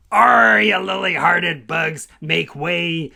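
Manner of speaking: loud growling pirate shout; pirate crab. Acted. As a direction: bellow it like a crab pirate boarding the ship. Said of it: "like a pirate's sword".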